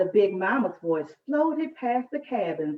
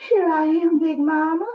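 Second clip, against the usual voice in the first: put-on high, loud, little girl answering; a child running up, calling out; breathless girl; 8 semitones higher, 6 dB louder than usual. A narrator's reading. Flossie answering her grandmother's call, high and breathless. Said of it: "Flossie said after catching her breath".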